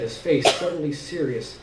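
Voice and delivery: usual speaking voice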